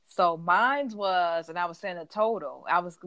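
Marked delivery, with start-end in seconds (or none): 0.0-1.5 slow mine's. Retold or imitated. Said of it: "I was like".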